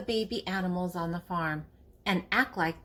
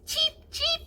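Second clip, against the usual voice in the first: very high tiny cheep; chick cheep. Acted. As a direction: peep like a baby chick. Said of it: "like a chick".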